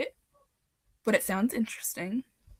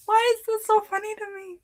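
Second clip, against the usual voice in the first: giggly; 11 semitones higher, faster than usual. Real, not acted. Giggling at her own comment.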